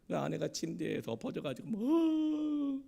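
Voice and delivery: normal talking voice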